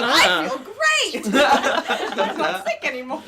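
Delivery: drawn-out, high, laughing exclamation; loud mock elation. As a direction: belt it out, comically overjoyed. Re-enacts cheerful reply to worried castmates.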